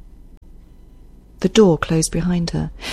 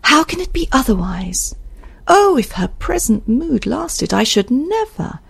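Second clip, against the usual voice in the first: higher, emphatic character voice; raised pitch, fretful and dramatic; distressed Isabel; 5 semitones higher than usual. Acted. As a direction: plead the case, stretching the key words. Reader voices Isabel in dialogue.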